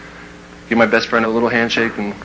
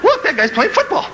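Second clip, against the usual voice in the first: put-on high boyish voice with a laugh; a kid pointing out the window, voice up high; young boy, excited and squeaky; pre-puberty voice; 13 semitones higher than usual. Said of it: "That was my voice before puberty".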